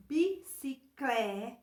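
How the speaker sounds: "bicicleta" broken into syllables, CLE drawn out; syllables sounded out. Shown as a demonstration. Teacher helping pupils hear the L sound, syllables sounded out. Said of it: "em voz alta para perceber os sons".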